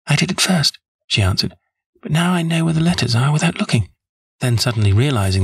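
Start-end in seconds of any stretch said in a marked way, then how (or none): 0.1-0.8 high-pitched client's voice; Miss Sutherland. A narrator's reading. Client answering the detective, high character voice. 2.0-3.9 high-pitched client's voice; Miss Sutherland. A narrator's reading. Client answering the detective, high character voice.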